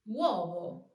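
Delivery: uovo with U glided into O; correct uovo, W glide. Shown as a demonstration. Teacher showing learners correct uovo with W glide. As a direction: say uovo with U as a quick W. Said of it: "weak U turns into a W sound".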